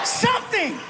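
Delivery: sudden high squeaky shout of one word; mock shout. Comic bellowing his job to the crowd, sudden high shout.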